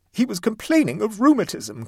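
high, loud, sobbing insistence; chilling inflection. A narrator's reading. Mother insists over the interruption, with would-be chilling inflection. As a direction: repeat it at high pressure, trying to chill. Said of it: "trying to throw a chilling inflection into a voice".

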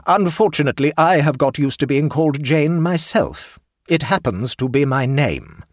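aunt coldly revealing her own name. A narrator's reading. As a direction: deliver it with frosty dignity.

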